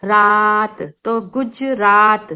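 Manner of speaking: teacher drawing out रात and गुजरात; slow demo. Shown as a demonstration. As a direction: stretch the aa of रात inside गुजरात. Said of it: "कैसा sound आता है बच्चों".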